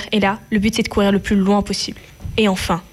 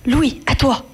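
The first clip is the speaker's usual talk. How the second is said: shouting; 6 semitones higher than usual. Acted. Escape signal to the group.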